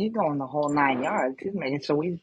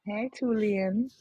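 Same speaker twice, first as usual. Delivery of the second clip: mock seductive; 6 semitones higher, 5 dB quieter, slower than usual. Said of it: "Nobody sounded so sexy when I came in".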